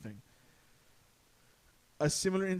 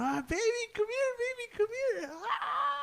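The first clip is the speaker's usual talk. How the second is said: put-on high, wailing call to a child; imitated frantic aunt; 4 dB louder than usual. Aunt crying out to her kids in fear, high frantic wail. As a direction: cry out like a panicked mother. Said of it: "everybody in the house yelling".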